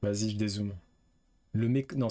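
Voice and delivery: normal talking voice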